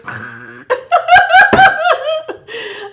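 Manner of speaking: loud high cackle; high, loud laughing fit; burst of laughter. Real, not acted. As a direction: crack up in a high peal. Laughing about boring readings.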